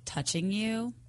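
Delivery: ordinary talk, nothing marked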